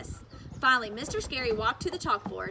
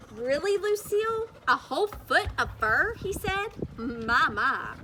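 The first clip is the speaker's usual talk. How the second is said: mock surprise; 5 semitones higher, 5 dB louder, slower than usual. A narrator's reading. Teacher doubting Lucille.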